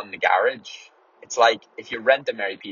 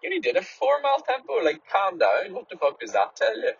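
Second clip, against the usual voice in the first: mocking girlfriend; 10 semitones higher than usual. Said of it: "she'd be like".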